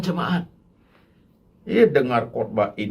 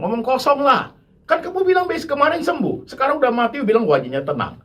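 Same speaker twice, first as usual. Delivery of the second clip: loud, high scornful rebuke; heated pulpit indignation; indignant preaching; 9 semitones higher, 5 dB louder than usual. Acted. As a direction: lash out at the false pastor.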